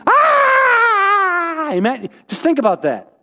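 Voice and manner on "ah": high hammed-up "Ah!" scream; a sudden mock scream of a possessed man; mock demon scream. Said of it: "shows up screaming".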